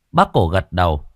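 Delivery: ordinary delivery, nothing marked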